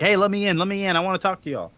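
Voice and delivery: high-pitched, insistent calling to get in; mock demanding. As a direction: act out pestering at the gate. Retold Scrooge demanding to get in.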